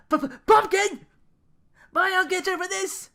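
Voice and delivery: stammering high, loud panic; panicked. Acted. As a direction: sputter then swear revenge. Panicked prince vows revenge.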